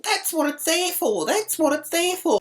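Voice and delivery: very high-pitched nagging imitation; maddening high singsong harping; mock nagging repetition. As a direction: mimic her repeating it, voice shot right up. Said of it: "She kept saying it".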